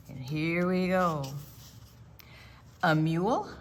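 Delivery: usual speaking voice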